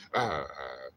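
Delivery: low doubled dog grumble; imitated dog grumble. Owner imitating his dog's reply, low grumbling. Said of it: "He'll be like".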